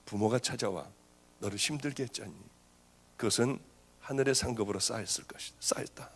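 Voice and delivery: hushed, tender voice fading to a breath at the end; gentle divine comfort. Retold or imitated. The Holy Spirit consoling the anxious pastor, hushed and tender. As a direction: soothe him like a loving father speaking to his servant.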